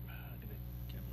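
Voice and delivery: quick whisper to himself. Real, not acted. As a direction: whisper it fast under your breath. Muttering to himself while setting up.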